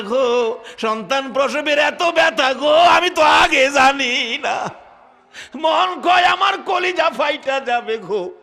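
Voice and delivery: high, loud cry of labour pain; screaming in pain. Acted. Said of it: "চিৎকার দিয়ে বলছে".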